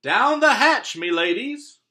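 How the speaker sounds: very high, very loud pirate toast; pirate yelling. Acted. Braid Beard yelling a toast at dinner, very loud and high. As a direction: bellow it while pounding the table. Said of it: "pounded his fist on the table and yelled".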